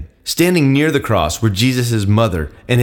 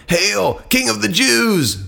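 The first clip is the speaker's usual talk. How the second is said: high, slow sneering hail; mock the prisoner as a fake king; drawn-out scornful hail; 10 semitones higher, much slower than usual. A narrator's reading. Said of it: "they mocked".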